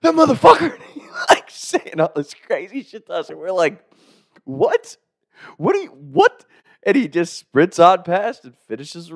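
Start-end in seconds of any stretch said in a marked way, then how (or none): 0.0-0.7 high, strained loud yell; furious retold shout; out-of-breath cursing. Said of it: "he's, like, out of breath". 4.4-6.4 high-pitched disbelieving question; incredulous yelp; baffled. Retold or imitated. Retold baffled reaction to cursing.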